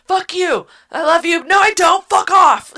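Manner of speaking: high, loud, flustered yelling; hammed-up love-hate outburst; mock frantic shout. Heroine torn between love and anger.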